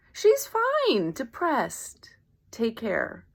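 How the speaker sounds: high cheery "fine", dropping to a whispered "Take care"; sugarcoated brush-off. Retold or imitated. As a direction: chirp it, then trail off to a whisper. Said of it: "Almost to maybe sugarcoat it".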